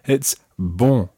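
"bon" with a strongly nasal vowel; nasal French "bon"; nasal syllable. Shown as a demonstration. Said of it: "the first syllable is very nasal".

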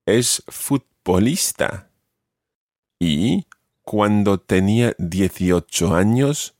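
slowly, phrase by phrase with pauses; measured dictation pace, gaps between short phrases; slow repeat. Shown as a demonstration. Teacher rereading quiz clues for learners, slowly. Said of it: "I'll repeat this again slowly".

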